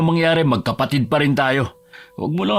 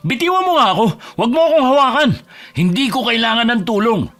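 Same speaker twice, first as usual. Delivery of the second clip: high-pitched, heated rejection; furious outburst; 7 semitones higher than usual. Acted. Husband tells friend to let go, furious. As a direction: burst out as if shaking off a grip. Breaks free, ends the friendship.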